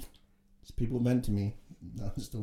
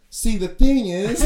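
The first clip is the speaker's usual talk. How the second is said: high, loud, slow imitation of an oversharer; exaggerated heartfelt preamble; mock earnest; 10 semitones higher, 13 dB louder, slower than usual. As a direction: stretch it out loudly as he launches back in. Imitates cleaner restarting his monologue.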